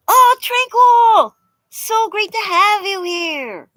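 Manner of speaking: high, loud, slow, delighted welcome; excited greeting. Real, not acted. Streamer welcoming a new viewer, real delight. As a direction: stretch out the hello with real joy.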